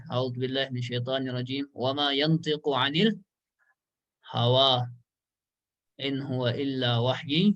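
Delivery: slow, drawn-out recitation of the verse; Quran recitation. Real, not acted. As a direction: chant the verse reverently.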